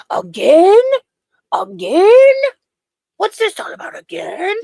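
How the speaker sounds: high, loud mock outrage rising at each end; imitated angry yelling. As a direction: mimic her yelling in disbelief. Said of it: "video of her yelling".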